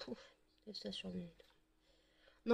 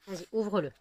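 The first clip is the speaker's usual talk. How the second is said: ordinary delivery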